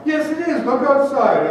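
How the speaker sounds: high, loud and bouncy; Tigger. Acted. Preacher as Tigger urging the friend outside, bouncy. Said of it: "But I was Tigger".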